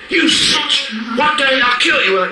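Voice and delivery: high-pitched yell, re-enacting a furious confrontation; acted angry outburst. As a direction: explode at him as in a retold rage. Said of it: "So I come up and go".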